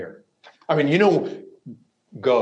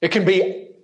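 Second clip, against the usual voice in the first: ordinary talk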